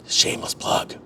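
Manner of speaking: a sly unvoiced whisper to the viewers; whispered aside. Real, not acted. Host whispering a sly aside to viewers after plugging a channel. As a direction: drop to a whisper for the cheeky plug.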